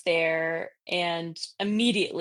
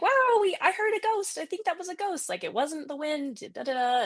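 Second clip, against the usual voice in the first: high-pitched awed gushing, gradually settling; retold excitement; 10 semitones higher than usual. Friend thrilled at hearing a ghost, imitated excited gushing. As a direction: start way up high, then come down. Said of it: "we got all excited".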